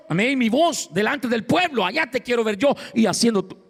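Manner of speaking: high, calling-out voice of God scolding Elijah; God rebuking. Acted. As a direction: call him out of the cave like God.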